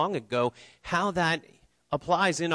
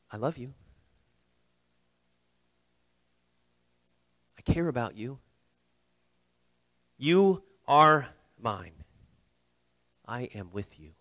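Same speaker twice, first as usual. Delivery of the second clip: tender words of Jesus; 3 semitones lower than usual. Acted. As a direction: speak it gently like a promise. Jesus' words spoken to listeners.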